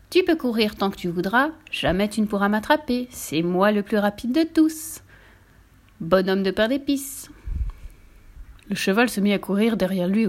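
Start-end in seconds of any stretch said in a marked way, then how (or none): none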